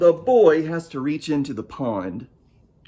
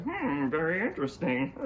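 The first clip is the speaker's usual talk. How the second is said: giraffe; 7 dB quieter than usual. Acted. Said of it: "that giraffe's like".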